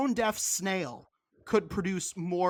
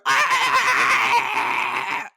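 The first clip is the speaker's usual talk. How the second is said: piercing high mock shriek, very loud; 9 dB louder than usual. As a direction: squeal it like a wailing performance art singer. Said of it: "she starts doing her".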